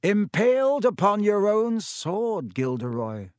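a dry, amused remark; Dumbledore, wry. A narrator's reading. Dumbledore voiced.

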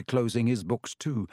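ordinary delivery

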